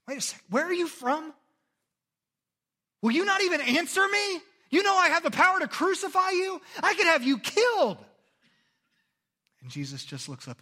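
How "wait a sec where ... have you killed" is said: much higher, loud pressing voice; frantic Pilate. Acted. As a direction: demand it like a rattled ruler. Retold Pilate, agitated.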